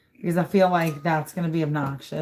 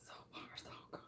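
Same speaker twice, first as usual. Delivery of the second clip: whispering; 11 dB quieter, faster than usual. Real, not acted. Tense moment during a pour.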